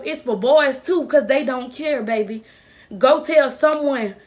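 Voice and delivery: loud, fervent exhortation to survivors; passionate plea. Acted. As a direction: preach it to every girl going through this, full force. Impassioned message to other victims.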